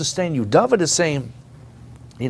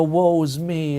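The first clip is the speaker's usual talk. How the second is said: higher-pitched parody of self-pity; mock woe; 5 semitones higher, 3 dB louder, slower than usual. Preacher imitating people who want their woes heard, mock-woeful. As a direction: imitate someone lamenting their troubles. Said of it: "listen to their woes and all their troubles".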